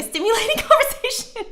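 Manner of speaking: real laughter, pitch jumping up; laughing. Real, not acted. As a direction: break into laughter mid-word. Teasing chat about sixties.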